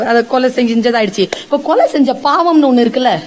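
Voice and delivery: normal talking voice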